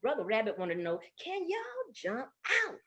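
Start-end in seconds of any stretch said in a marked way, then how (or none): 1.2-2.8 high, slow, soft question; singsong, teasingly soft query; coy question. A narrator's reading. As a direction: draw it out, high and quiet. Rabbit tests whether frogs can escape.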